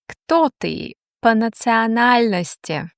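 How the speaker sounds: ordinary talk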